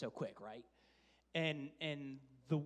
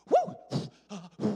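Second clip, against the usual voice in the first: loud, higher whoop of a nervous new driver; excited whoop; 3 semitones higher, 13 dB louder than usual. Retold or imitated. Dad imitating his daughter starting to drive, loud whoop. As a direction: whoop it out as she grabs the wheel.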